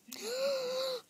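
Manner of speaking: long, high intake of breath; excited gasp. Acted. Friend hearing the guard plan, excited gasp. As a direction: gasp in delighted surprise. Doll reacts to guard idea.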